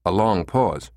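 ordinary delivery, nothing marked

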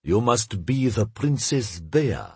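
slow, deferential greeting; courtly address. A narrator's reading. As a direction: address her slowly, as if bowing. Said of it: "gave a deep bow and said in Russian".

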